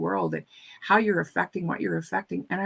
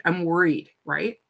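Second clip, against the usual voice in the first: normal talking voice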